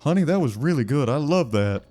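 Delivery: gushing, sing-song praise in a put-on voice; Uncle Bob. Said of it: "We'll say".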